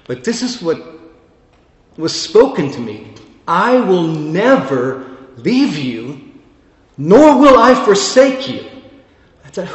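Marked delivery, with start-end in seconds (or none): 3.4-8.6 slow start, then soaring higher and louder on nor will I forsake you; building proclamation. Acted. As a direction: let the promise swell into a cry. Preacher repeats the verse, building.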